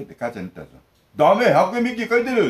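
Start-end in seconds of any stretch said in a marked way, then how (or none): 1.1-2.5 higher, louder, snapping 'ダメ' as the mother; mock scolding mother; mock mother's retort. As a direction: shoot back 'ダメ、百円引き書いてる' like a mom catching him out. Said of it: "って言ってバレてました".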